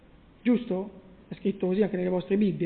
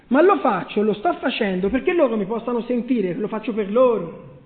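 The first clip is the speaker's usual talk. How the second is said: usual speaking voice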